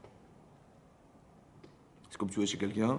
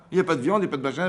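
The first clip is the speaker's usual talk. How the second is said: mock complaining outcry; 5 semitones higher, 5 dB louder, much faster than usual. Retold desert complaint, preaching.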